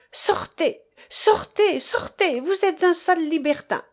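high-pitched outraged order to get out; furious dismissal. A narrator's reading. Furious women driving a man out of their room, high-pitched outrage. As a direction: throw each word at them, high and indignant.

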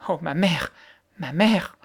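distressed cry. A narrator's reading. Girl stung by insult about her dead mother, distressed cry. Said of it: "s'écria Mary dans sa détresse".

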